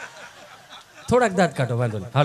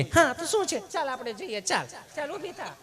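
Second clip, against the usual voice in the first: mock fussy woman visitor; 8 semitones higher, 5 dB quieter than usual. Woman visitor coaxing the dog.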